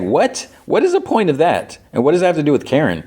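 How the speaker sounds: the recapper fed up with a pointless scene; exasperated. Real, not acted. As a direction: fire off the questions, baffled. Viewer's exasperated reaction to recap.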